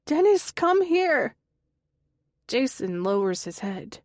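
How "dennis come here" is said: high, loud, slow calling out; calling shout. A narrator's reading. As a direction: call him to come. Connie calling from her room.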